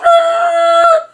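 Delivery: very high, drawn-out tire squeal; imitated car screech. Man telling how the driver pulled off, imitated screech. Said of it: "So he pulls off".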